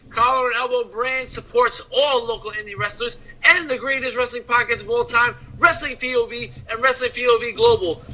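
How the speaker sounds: ad read. Acted. Host reading a sponsor spot to listeners, soft ad read. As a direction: sell the shirts like a host ad.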